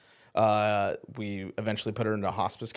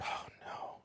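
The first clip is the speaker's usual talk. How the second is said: whispered, sinking realization; voiceless, very soft dismay, retold; hushed dread; 17 dB quieter than usual. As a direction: let it out as barely a whisper. Retold dread as wife dies.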